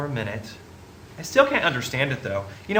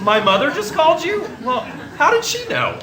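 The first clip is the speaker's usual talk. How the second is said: flustered, much higher; surprised; 9 semitones higher than usual. Acted. Young man caught out by his mother's phone call, flustered and much higher. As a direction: squeak it out, caught off guard.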